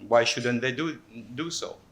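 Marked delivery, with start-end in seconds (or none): none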